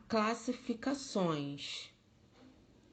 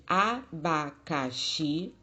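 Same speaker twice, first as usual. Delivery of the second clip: abacaxi broken into a-ba-ca-xi, each beat separate; syllable by syllable; 3 dB louder, slower than usual. Shown as a demonstration. Teacher counting out syllables for pupils, abacaxi in separate beats. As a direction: say abacaxi one syllable at a time.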